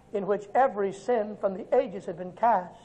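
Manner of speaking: ordinary talk, nothing marked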